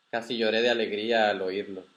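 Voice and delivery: ordinary delivery, nothing marked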